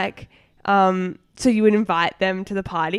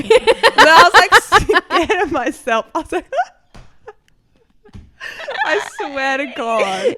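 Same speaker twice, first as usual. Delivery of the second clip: laughing; 9 semitones higher, 7 dB louder than usual. Real, not acted. Laughing at her own shock.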